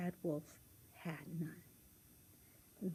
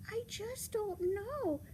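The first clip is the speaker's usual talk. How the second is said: put-on high little-girl voice; high-pitched childlike dismay; 9 semitones higher than usual.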